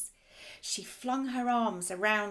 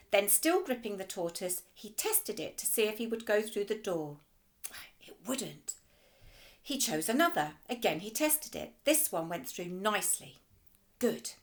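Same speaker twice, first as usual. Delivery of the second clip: ordinary delivery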